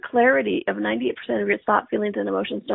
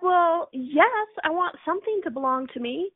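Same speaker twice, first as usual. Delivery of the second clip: higher-pitched, retold insistence; mock protest; 6 semitones higher than usual. Student answering the teacher's question, mildly protesting. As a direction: plead your case lightly, pitched up.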